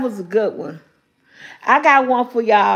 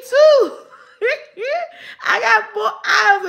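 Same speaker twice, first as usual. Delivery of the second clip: laughing; 8 semitones higher than usual. Real, not acted. Laughing at own joke.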